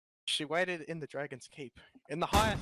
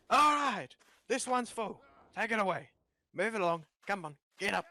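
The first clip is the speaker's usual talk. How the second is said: higher, louder barked orders that fade down; gruff guard; 5 semitones higher, 4 dB louder than usual. Pretend. Reading the guard's lines to friends, bossy barked commands.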